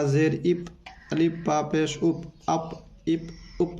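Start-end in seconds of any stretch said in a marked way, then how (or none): 2.5-3.8 अप इप उप said softer, each syllable clear; softer syllable demo. Shown as a demonstration. Teacher modelling joined syllables for pupils, soft. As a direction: model अप इप उप quietly. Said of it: "इस तरीके से आप इसको पढ़ना है मिला के".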